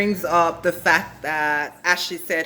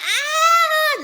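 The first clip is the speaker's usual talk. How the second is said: high, loud drawn-out mock scream; excited squeal; 5 dB louder than usual. Recapper as episode ends, hammed-up high squeal.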